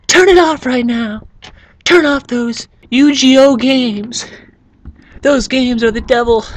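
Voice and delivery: high, slow mock scolding mom; angry mom. Mom scolds her kid to turn off the card games, high and slow. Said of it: "I know how moms can be".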